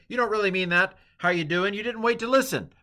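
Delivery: hammed-up indignant complaint; mock upset complaint. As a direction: play the person who is put out that nobody waits for an answer. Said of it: "people kind of sometimes get upset and say".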